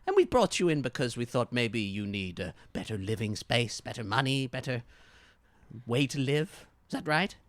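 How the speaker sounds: loud, put-on detective questioning. Detective questioning a suspect about the slums, loud put-on voice. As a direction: lean on the suspect like a condescending cop. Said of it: "He looks at you and he says".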